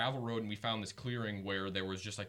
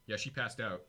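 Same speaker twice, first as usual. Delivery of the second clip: ordinary delivery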